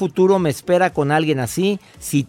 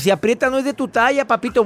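higher, teasing repeat of the quote; a higher, playful second reading; 8 semitones higher, faster than usual. Shown as a demonstration. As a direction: lift it up and tease it, ending on "papito". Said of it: "Vuélvelo a escuchar".

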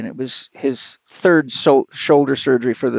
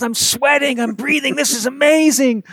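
much higher, fast, gushing with delight; thrilled patient; 10 semitones higher than usual. Retold or imitated. Pro pitcher raving to his therapist, excited and high. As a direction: bubble over like a patient who finally got to work out. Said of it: "he was high as a kite".

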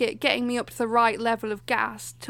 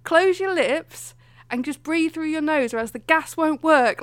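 slow, louder mock instruction; bossy dentist; 5 semitones higher, 6 dB louder, slower than usual. Dentist orders the patient to breathe through her nose, mock stern. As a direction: spell it out like a strict instructor. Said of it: "like that".

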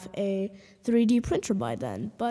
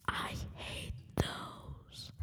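slow whispered aside; whispering; 15 dB quieter, much slower than usual. Acted. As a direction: hiss it under your breath.

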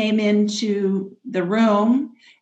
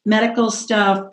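usual speaking voice

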